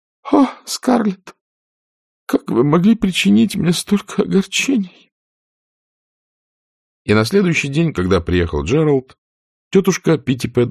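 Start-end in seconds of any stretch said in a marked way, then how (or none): 0.0-5.1 tearful reproach. A narrator's reading. Aunt Pittypat tearfully reproaches Scarlett. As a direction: sob out a hurt reproach.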